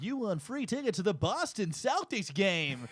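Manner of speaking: hammed-up giveaway announcer voice; radio announcer. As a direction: stretch it out like a radio host. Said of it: "like, a radio announcer".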